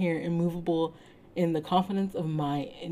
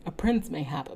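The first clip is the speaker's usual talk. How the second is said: plain reading voice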